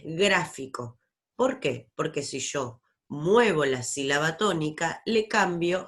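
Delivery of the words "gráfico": gráfico with a firm GRÁ at the start; correct first-syllable stress. Shown as a demonstration. Teacher correcting to the real word, first-syllable stress on gráfico. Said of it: "es gráfico".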